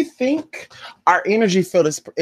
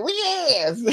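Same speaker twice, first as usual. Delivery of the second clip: sassy agreement; 10 semitones higher, 4 dB quieter than usual. Retold or imitated. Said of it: "I'm like".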